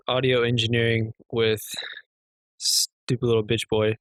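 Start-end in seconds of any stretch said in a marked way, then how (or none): none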